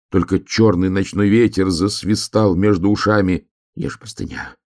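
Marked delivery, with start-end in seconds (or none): 3.8-4.6 hushed, then suddenly higher; mild panic rising from a near whisper; hare's anxious thought. A narrator's reading. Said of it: "думал заяц".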